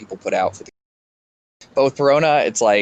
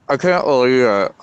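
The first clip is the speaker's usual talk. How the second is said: talking around a phone held in teeth; muffled, phone clamped in mouth; 2 semitones higher, 4 dB louder than usual. Real, not acted. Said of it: "I was holding my phone in my mouth".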